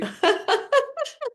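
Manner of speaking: high crying laugh; laughing fit; shrieking laugh. Shown as a demonstration. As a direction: laugh till it squeals. Laughing tantrum demo.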